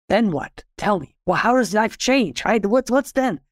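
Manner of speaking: high, eager pleading questions; excited student. Acted. Student asking the master about life after enlightenment, excited. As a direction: pester the master with pitch leaping up. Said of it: "He gets excited".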